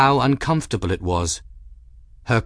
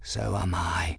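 low, soft, resigned echo; very subdued and burdened; heavy, weary; 4 semitones lower, 8 dB quieter than usual. A narrator's reading. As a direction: sink into a hushed, weary admission. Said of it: "His tone was heavy, weary".

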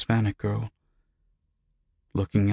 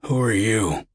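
higher-pitched, firm demand; hardened demand; 4 semitones higher than usual. A narrator's reading. Patient challenging the unseen voice, hard and demanding. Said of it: "he demanded, his tone hardening".

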